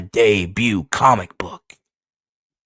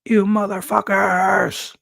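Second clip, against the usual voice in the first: much higher, slow, crying out; a tortured hero cries out at his captors; anguished cry; 12 semitones higher, slower than usual. Acted. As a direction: scream it in pain and rage. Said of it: "Kenny Karate's all like".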